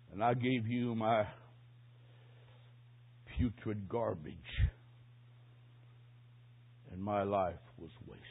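low, soft, halting shame; acting out hushed regret before God; remorseful confession. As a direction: confess quietly with long, heavy pauses. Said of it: "regret and remorse".

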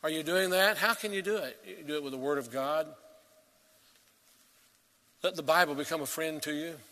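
normal talking voice

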